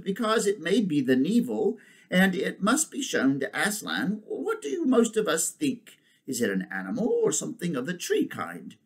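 high, swooping elephant voice; decisive she-elephant. Acted. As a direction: address the assembly as the elephant. Elephant figure in story.

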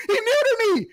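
high laughing squeal. Real, not acted. Laughing at being muted.

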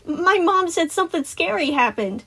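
put-on high, loud little-boy voice; piping child. A narrator's reading. Boy reporting what his mom said, piping up. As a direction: pipe up like a curious kid. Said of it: "Tyler piped up".

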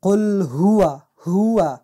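هو with the ه drawn out loudly; stretched h. Shown as a demonstration. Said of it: "از هی را کش کنیم".

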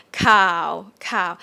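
"cow" starting low and sinking. Shown as a demonstration. Teacher models the Thai low tone, sinking "cow". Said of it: "Sounds depressing here".

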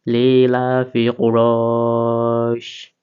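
slow melodic chant of লি লা ফি Quraish, drawn out; Quranic recitation. Shown as a demonstration. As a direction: recite the whole phrase as tilawat, stretching the vowels. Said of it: "শেষে গিয়ে আমাদেরকে সিনটা উচ্চারণ করতে হবে".

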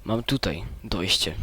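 ordinary talk

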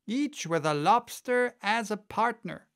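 high, eager interjection; crying out. A narrator's reading. Gryphon cutting into the Mock Turtle's explanation, high cry. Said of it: "cried the Gryphon".

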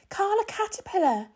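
high, loud excited call of a name; cried out. A narrator's reading. Lucy calling out to the passing caterpillar, high and bright. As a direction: call out to her with delight. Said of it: "cried Lucy".

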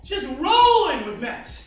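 ordinary talk

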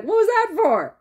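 lightly acted indignant question; mock indignant Fatty. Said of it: "and Fatty's like".

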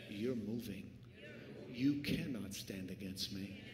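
hushed, steady conviction; quiet exhortation. Real, not acted. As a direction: speak right to their heart.

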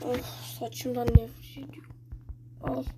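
breathy whisper under the breath. Real, not acted. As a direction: whisper the complaint to yourself. Frustrated gamer muttering under breath.

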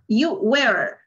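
American "You were" with the R sounded; American accent. Shown as a demonstration. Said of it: "Aquí sí se pronuncia la R".

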